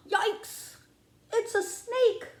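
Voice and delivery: high gasp, then a squeaky yikes; mock fright. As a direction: play scared of the snake for little listeners. Snake reveal, scary.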